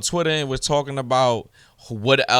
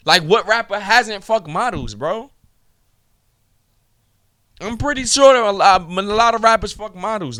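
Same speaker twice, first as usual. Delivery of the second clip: raised, heated put-down, pitch high throughout; heated insults; 5 semitones higher than usual. Real, not acted. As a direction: spit the insults at him, heated. Angry rant at a rival.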